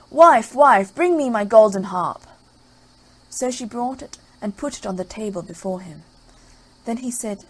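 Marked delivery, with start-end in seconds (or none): 0.1-2.3 loud bossy shout; ogre calling out. Acted. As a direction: call for the wife across the house. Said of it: "the ogre called out".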